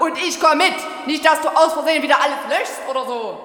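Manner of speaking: laut und schnell hinterhergerufen; keifend laut. Acted. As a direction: ruf ihm misstrauisch nach. Alte keift misstrauisch hinterher. (laut und hastig gekeift)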